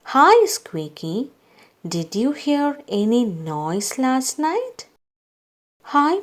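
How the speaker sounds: plain reading voice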